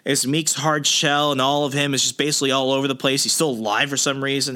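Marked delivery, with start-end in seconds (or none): none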